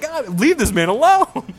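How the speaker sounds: high, loud, drawn-out mock protest; exasperated plea. Teller begs actor to spare his friend, high exasperated plea. Retold plea to stop the insults.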